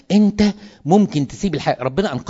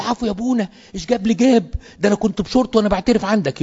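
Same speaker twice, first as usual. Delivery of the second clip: much higher voice, a mock plea; humble mock protest; 8 semitones higher than usual. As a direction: pitch it high, like a young priest objecting to his elder. Young priest protesting to confessor. (احتجاج متواضع بصوت عالي الطبقة)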